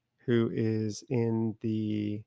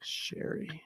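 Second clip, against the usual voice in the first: whisper; 4 semitones higher, 12 dB quieter than usual. Real, not acted. Savoring the word sherry.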